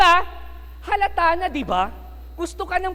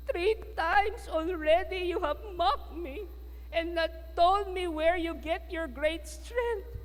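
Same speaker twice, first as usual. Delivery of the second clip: higher, slow, softer reproach; Delilah reproaching; 6 semitones higher, 4 dB quieter, slower than usual. Acted. Delilah reproaching Samson for mocking her, slow and hurt. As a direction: wound him with a hurt complaint. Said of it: "ito ang sabi niya kay Samson".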